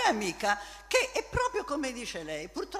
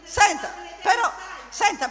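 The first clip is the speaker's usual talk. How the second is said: calling out; 6 semitones higher, 6 dB louder than usual. Real, not acted. Calling out to get attention.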